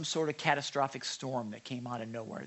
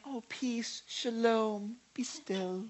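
lightly voiced soft, higher gentle shush; a mild, sweet hushing; gentle mock hush; 6 semitones higher, 5 dB quieter, much slower than usual. Shown as a demonstration. How Jesus did not speak to the storm, mild and soft. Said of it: "he's not saying".

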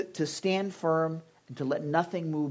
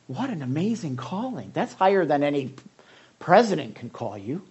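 normal talking voice

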